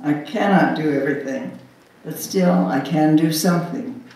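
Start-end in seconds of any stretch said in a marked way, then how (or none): none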